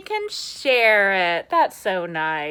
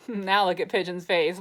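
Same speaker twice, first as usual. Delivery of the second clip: plain reading voice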